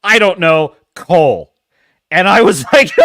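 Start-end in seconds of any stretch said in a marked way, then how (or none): 0.0-1.5 trolling guess. Retold or imitated. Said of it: "he decides to troll me".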